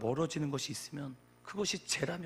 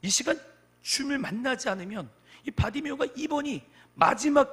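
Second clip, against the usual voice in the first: urgent, high and loud sermon voice; impassioned preacher; forceful preaching; 8 semitones higher, 8 dB louder than usual. Real, not acted. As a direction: press the urgency on the congregation.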